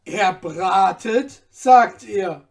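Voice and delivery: loud, slow, incredulous echo; astonished cry. A narrator's reading. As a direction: repeat it loudly in great amazement. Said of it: "rief der Sklave mit großem Erstaunen".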